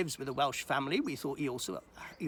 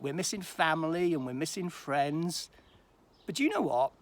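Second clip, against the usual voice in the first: usual speaking voice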